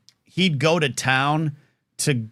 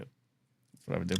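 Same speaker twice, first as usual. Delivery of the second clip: whispered repeat; 8 semitones lower, 13 dB quieter than usual. Shown as a demonstration. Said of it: "repeated whispered".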